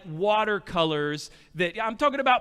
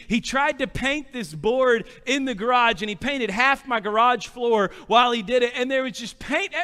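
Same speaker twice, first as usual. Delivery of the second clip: ordinary delivery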